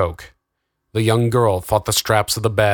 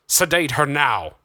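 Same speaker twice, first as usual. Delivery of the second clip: understated shout, raised pitch and drawn out; higher, slower urgent order; mock shout; 6 semitones higher, slower than usual. A narrator's reading. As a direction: call out an order over noise. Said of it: "Darren shouted over the cacophony".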